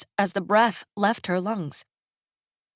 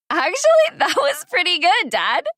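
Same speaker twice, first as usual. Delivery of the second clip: put-on much higher, louder teasing girl's voice; 12 semitones higher, 6 dB louder than usual. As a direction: tease dad with a giggle in it. Daughter laughing, teasing her dad.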